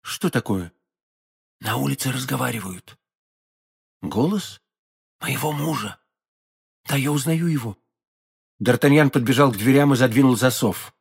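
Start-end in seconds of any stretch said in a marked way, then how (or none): 0.0-7.8 soft, wary undertone; hushed alarm. A narrator's reading. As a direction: speak softly so as not to be overheard. Hushed listening to voices outside. (настороженно, вполголоса)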